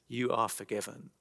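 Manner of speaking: soft, hushed and tender; gentle forgiveness. Acted. Jesus gently telling the paralysed man his sins are forgiven, softly. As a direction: lay the words down gently, like a blessing.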